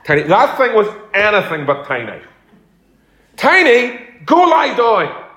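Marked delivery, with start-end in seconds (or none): 3.4-4.0 calling the dog's name loud and high; mock owner's shout. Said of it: "they'd shout". 4.2-5.2 loud, high, slow order to the dog; mock owner's command. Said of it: "they'd shout".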